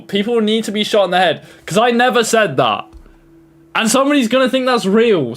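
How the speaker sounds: high-pitched, worked-up denial; indignant protest. Real, not acted. Streamer denies the fake AI clip to viewers, indignant and high-pitched.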